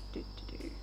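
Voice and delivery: normal talking voice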